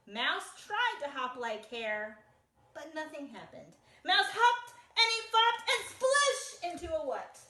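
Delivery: put-on lively picture-book read-aloud for kids; reading to children. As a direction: tell it like a storybook to little listeners.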